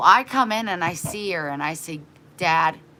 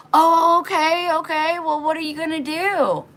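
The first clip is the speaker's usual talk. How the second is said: flustered neighbor; 11 semitones higher, 6 dB louder than usual. Retold or imitated. Neighbor startled when dad walks in, high and flustered. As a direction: act caught off guard, sugary.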